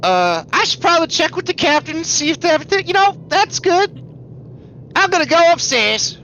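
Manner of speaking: flustered retreat; nervous. Acted.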